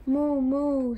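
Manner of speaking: cow's moo. A narrator's reading. Reading the cow to kindergartners, long moos. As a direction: moo gently like a picture-book cow. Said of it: "said the cow".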